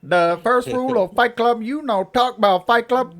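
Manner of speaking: put-on high, loud Cambodian accent. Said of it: "Cambodian's not good".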